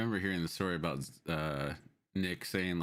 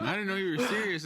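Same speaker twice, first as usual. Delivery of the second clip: laughing quote; 11 semitones higher, 3 dB louder than usual. Retold or imitated. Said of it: "repeats Ralph's line".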